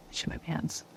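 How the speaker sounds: hushed, sheepish aside; whispered confession. Pretend. Wife admitting an accident to poor husband, whispered. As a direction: drop right down to a whisper.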